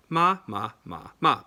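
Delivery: ma said four times, each with a different Mandarin tone. Shown as a demonstration. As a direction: sweep through the four tones on ma. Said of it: "all mean different things".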